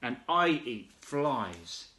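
slow, drawn-out boast; slow proud. Acted. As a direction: stretch each word out slowly. Frog's proud reply.

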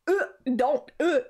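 loud, high revolted protest; grossed-out yelp with gagging grunts; disgusted outburst. Real, not acted. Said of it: "I am going to gag out of disgust".

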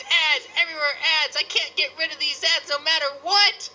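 normal talking voice

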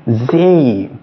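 "Z" said as a drawn-out J, "jay"; longer, emphasized letter. Shown as a demonstration. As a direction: stretch the vowel in "Z" with emphasis. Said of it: "You need little more duration and emphasis".

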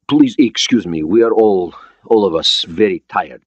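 put-on Russian-accented, halting English; foreign accent. Borodin excusing his tired crew, Russian accent. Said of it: "He had an accent, but his English was understandable".

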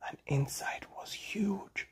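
hushed whisper of wonder. A narrator's reading. Father telling his child about the rocket's inside, whispering. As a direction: breathe it like a secret.

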